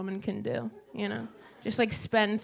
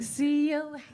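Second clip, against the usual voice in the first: mom voice; 6 semitones higher than usual. Retold or imitated. Mom voiced, far timbre.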